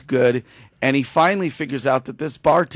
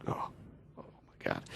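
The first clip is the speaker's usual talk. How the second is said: imitating her, barely whispered and low; retold tearful gasp; 18 dB quieter than usual. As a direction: act out overwhelmed whispering, on the edge of crying. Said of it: "She starts crying".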